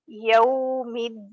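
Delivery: Yawmiddin slow, with yaw gliding a to u; correct recitation. Shown as a demonstration. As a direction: draw out the final din of Yawmiddin. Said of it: "we don't have to read it like yo".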